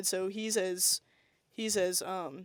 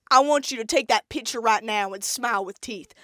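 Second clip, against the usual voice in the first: louder, bossy Southern mama twang; Southern mom; 3 semitones higher, 7 dB louder than usual. Retold or imitated. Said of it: "zero to a hundred with Southern moms".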